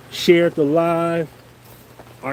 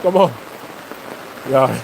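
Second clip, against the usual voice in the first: calling the dog; 4 semitones higher than usual. Real, not acted. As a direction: shout for the dog to come. Chasing dog in the rain.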